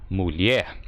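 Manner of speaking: usual speaking voice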